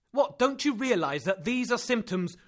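high and loud insistence; urgent disbelief; alarmed protest. Acted. Axel protests.

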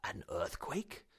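mildly amused disbelief; calm doubt. Acted. Uncle unimpressed.

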